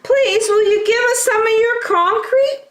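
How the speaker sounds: little wolves. Acted. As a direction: ask sweetly in tiny voices. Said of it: "asked the three little wolves".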